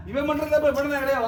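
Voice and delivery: loud, high-pitched objection. Real, not acted. Healer argues with the spirit over its threat, protesting loudly. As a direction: object forcefully, pitch and volume up.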